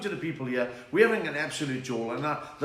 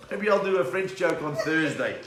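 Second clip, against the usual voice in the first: normal talking voice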